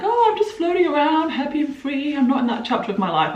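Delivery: high, light and airy carefree voice; floating along without a care; carefree dreamy. Retold or imitated. To herself, about friends not marrying yet: high carefree sing-song. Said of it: "I've just been like".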